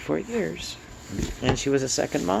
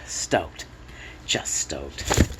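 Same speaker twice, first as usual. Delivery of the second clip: hushed; 10 semitones lower, 5 dB quieter, much slower than usual. Real, not acted. Quiet joy.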